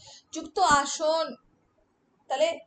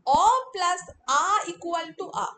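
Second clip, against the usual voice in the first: "অ plus আ equal to আ" slow, firm and a bit louder; slow rule drill; 6 dB louder, slower than usual. Shown as a demonstration. Teacher introduces the next rule to the class, slow and firm. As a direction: dictate the new rule clearly. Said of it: "এবার পরের নিয়মটা দেখো".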